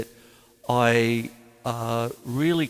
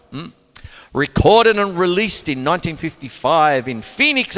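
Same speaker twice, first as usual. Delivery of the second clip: a louder, brighter reading voice, high and fast; reading the facts out like a radio presenter; read-aloud biography; 4 semitones higher, 6 dB louder than usual. Acted. Reading his biography aloud to viewers in a louder presenter voice.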